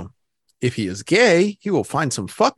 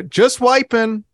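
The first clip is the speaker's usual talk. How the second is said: higher, slow, strained; nervous kid voice; 9 semitones higher, 5 dB louder, slower than usual. Pretend. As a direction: squeak it out nervously.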